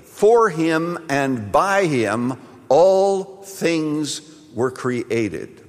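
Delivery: stretched-out, deliberate words; slow, weighty repetition. Acted. Preacher savours the verse for the congregation, slowly. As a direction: let each word land slowly.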